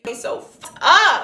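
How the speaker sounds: high, loud, slow outburst of disgust; outraged. Real, not acted. Friend venting about the bouncer, a high, loud outraged cry. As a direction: let the outrage burst out, high and drawn out. Outraged at being turned away.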